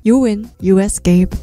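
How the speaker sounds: usual speaking voice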